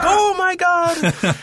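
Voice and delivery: high, slow amazed gasp; excited gasp. Retold or imitated. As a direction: gasp in awe like a fan. Crowd freaking out, retold.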